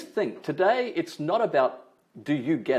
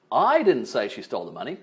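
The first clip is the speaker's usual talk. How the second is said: I punched, the rest running on; stress on I. Shown as a demonstration. Trainer showing how emphasis shifts meaning, stress on I. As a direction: lean hard on the first word, I. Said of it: "if I put emphasis on different words".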